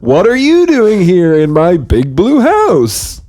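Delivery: put-on cheery kids' show bear voice; friendly bear host. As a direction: welcome the kids into the big blue house. Bear sketch continues.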